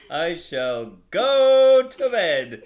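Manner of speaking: slow, loud, grand regal decree through laughter; royal pronouncement. Acted. Father voicing Peter as a slow royal pronouncement. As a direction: draw it out like a king's proclamation. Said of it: "comes the royal pronouncement".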